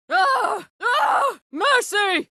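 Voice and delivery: high shouted cries, then a plea; desperate high cries for mercy; begging for mercy. Acted. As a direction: yelp in pain and beg to be spared.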